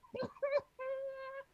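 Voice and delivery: high-pitched cackling laugh; witch cackle. Acted. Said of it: "nothing but my cackle".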